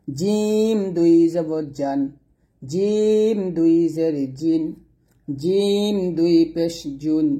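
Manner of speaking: slow, deliberate tanween recitation; teacher's slow letter drill. Shown as a demonstration. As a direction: chant each syllable slowly for students. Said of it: "এরকমভাবে উচ্চারণ করবেন".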